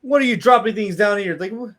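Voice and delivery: man in the hole calling up. Acted. Man stuck in a hole calls up to the players, high and startled. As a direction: call up from the hole, high and surprised. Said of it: "he's like".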